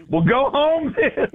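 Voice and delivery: host mocking the pastor's blunt reply. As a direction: tease the pastor's blunt dismissal. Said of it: "the pastor stood up ... and says".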